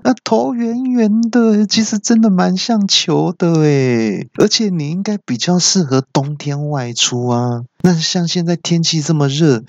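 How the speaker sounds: high-pitched jeering taunt; mocking. Villains mock the monk's name.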